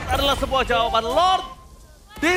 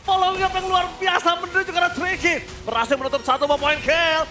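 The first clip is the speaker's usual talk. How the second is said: excited play-by-play; 9 semitones higher than usual. Real, not acted. Caster calls the play.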